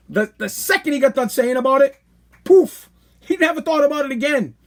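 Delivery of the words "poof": a quick airy poof, higher and louder; light mocking poof; poof sound. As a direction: blow the thought away with a poof. Dismissive sound effect.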